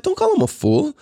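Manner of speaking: wife tells him off for calling their son fool; mock scolding mom. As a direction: correct him like a shocked parent. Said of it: "she's like".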